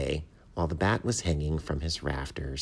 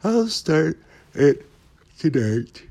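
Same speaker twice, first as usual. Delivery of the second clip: slow, high, yawning words; stretched-out drowsy yawn; sleepy; 12 semitones higher, 10 dB louder, slower than usual. A narrator's reading. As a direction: talk right through a big yawn. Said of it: "said the bat sleepily".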